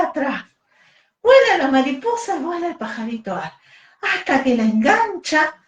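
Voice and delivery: put-on bright, lively voice reading to little children; storytelling for kids. A narrator's reading. Teacher telling kids the baby bird story, animated storyteller. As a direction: tell it like a bedtime tale for a class.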